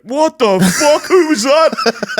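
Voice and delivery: very high, loud, slow startled cry; startled partygoer. Retold or imitated. Partygoers find the vodka gone, high startled cry. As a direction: squeal it out as a baffled drunk. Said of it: "we just heard of him like".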